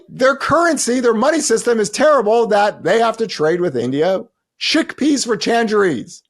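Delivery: high, loud incredulous mockery; mocking disbelief. He mocks the dollar-collapse crowd with high, incredulous scorn. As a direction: scoff at them like they're fools. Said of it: "I was like".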